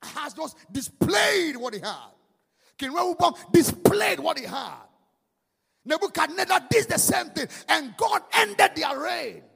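loud, high, driving sermon voice; fiery preaching. Acted. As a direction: preach it hard to the congregation. Preacher building to full force.

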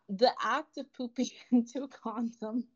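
real giggles breaking into the reading; reading while laughing. Real, not acted. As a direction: try to read it out but crack up. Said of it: "read without laughing".

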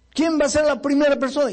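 very high, projected question; calling out an invitation. Retold or imitated. Preacher asking the congregation on the third night, calling out. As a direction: throw the question out high to the hall.

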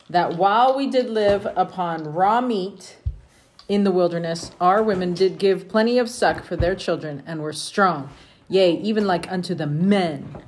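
steady, measured reading of scripture. Acted. Parent reading scripture to the family, measured and steady. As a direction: read it aloud to the family like scripture.

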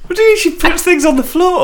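high, fast, disbelieving; can't believe what she heard; incredulous. Real, not acted. Incredulous at fridge story.

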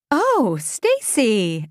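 drawn-out exclamation of greeting; slow, exclaimed hello in character; exclaiming. A narrator's reading. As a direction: stretch it out slowly as you exclaim. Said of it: "Eveline exclaimed".

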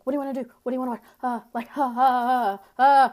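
imitating a hyped-up guy; mock manic guy. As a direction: ham up his jittery energy. Mocking his manic rambling.

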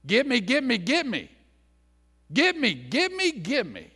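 high, whiny "give me" chant; a greedy child's high grabby whine; greedy grabbing voice. Acted. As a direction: beg like a greedy child, pitch climbing. Greedy closed-handed person voiced.

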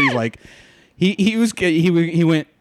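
ordinary talk

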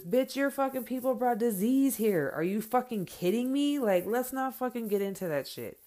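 high, loud, indignant clapback; angry retort. Acted. Mother telling off the woman who accused her son, angry retort.

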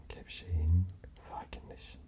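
fading into a whisper. Acted. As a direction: let the words dissolve to breath. Relaxation cues fading to whisper. (fısıltıya dönen ses)

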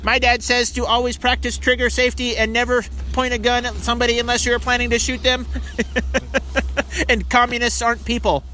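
put-on high kid voice, chirpy and proud; lightly voiced child reciting. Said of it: "another one's like".

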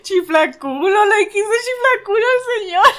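high, laughing through tears; laughing hard. Real, not acted. Listener cracking up at the story, high and teary. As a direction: crack up at the punchline.

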